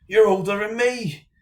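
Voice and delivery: a jokey English-Irish brogue telling a lad to get away; mock English-Irish accent. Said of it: "Don't know what accent that was".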